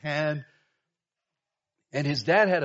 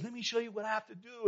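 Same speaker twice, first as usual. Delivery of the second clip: put-on excited kid; 8 semitones higher, 5 dB quieter, faster than usual. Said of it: "He was so excited".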